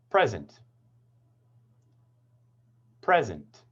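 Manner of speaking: "Present", first syllable stressed and falling away. Shown as a demonstration. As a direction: lean on the PRES of "Present", then let it fall. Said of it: "the pitch when I say it".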